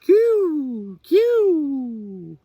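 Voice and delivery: very high, loud hooting coo; owl call. A narrator's reading. Mother owl calling from across the trees, high loud coo. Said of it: "heard a familiar sound".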